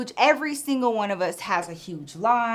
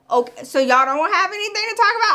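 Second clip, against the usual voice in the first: baffled; 9 semitones higher, 5 dB louder than usual. Retold or imitated. Cashier baffled by staring teens.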